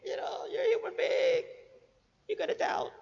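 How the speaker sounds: high-pitched, hammed-up sympathy; mock indulgent excusing. Preacher mimics an overly accepting God, mock indulgent. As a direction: coo excuses in an exaggerated high voice. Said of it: "He doesn't just sit there and say".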